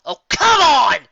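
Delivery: loud, high and fed up; pony bursting in, exasperated shout; exasperated outburst. Acted. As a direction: throw up your hands: oh come on. Raindrops bursts in, annoyed.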